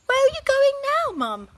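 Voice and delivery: put-on high, loud girl's whine; Laura, bored and high; whiny child. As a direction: complain like a bored kid. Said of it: "asked Laura".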